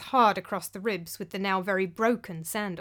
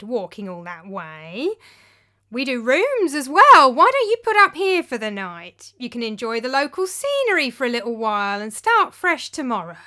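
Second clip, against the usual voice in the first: greasy innkeeper; 3 semitones higher, 8 dB louder than usual. Acted. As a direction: sell the room, all fake warmth. Taverna owner urging them to stay.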